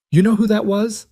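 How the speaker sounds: high, sharp demand. A narrator's reading. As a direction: round on him and demand an answer. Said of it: "Beatrice demanded".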